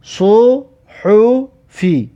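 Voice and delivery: صحفی drawn out slowly in Arabic; slow recitation. Shown as a demonstration. Teacher reading a Quran word to students, slow and deliberate. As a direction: sound out صحفی syllable by syllable. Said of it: "teacher reads Quran word aloud".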